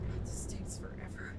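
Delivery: frustrated whisper under breath; whispered complaint. Real, not acted. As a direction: hiss it out, annoyed. Frustrated aside while waiting.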